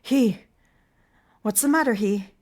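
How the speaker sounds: loud, high panicked yell; frightened shout. Acted. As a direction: scream it in fright. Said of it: "shouting in a frightened voice".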